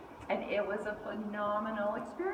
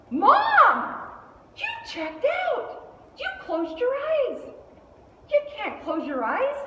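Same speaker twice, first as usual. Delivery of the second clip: loud, high-pitched indignant shout; scolding daughter; 8 dB louder, slower than usual. Retold or imitated. Daughter scolding Mom for closing her eyes, indignant shout.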